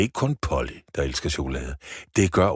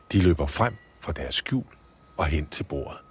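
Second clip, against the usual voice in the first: usual speaking voice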